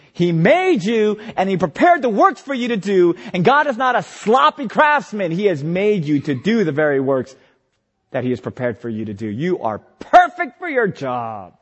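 rising, slowed emphatic preaching to his own soul; self-preaching. Acted. Preacher urging himself he is made by God, rising fervor. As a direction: tell yourself you are made for this.

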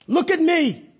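high insistent demand; crying for consideration. Acted. David crying to God for consideration, high insistent plea. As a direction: demand to be noticed. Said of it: "He's crying for consideration".

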